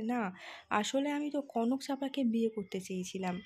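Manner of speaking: usual speaking voice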